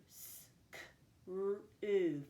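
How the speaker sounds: S and K as bare voiceless sounds, then R and OO; four separate sounds for SCREW; sounded out. Shown as a demonstration. Teacher counting four sounds in a syllable, sounded out. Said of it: "four sounds".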